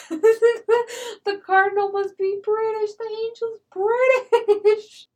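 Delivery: high, loud squeal through laughter; laughing hard. Retold or imitated. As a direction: shriek it slowly, cracking up. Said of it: "laughing so hard at the cardinal".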